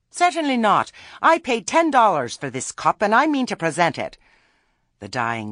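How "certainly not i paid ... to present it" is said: high, loud, outraged insistence; indignant refusal. A narrator's reading. Missus Earwig refuses to give up.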